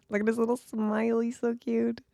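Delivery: very high, fast cooing squeal; fangirling over the doctor's little smile, high cooing squeal; gushing squeal. Real, not acted. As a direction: squeal over how cute he is.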